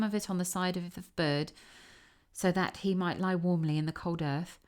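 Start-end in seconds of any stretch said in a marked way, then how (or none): none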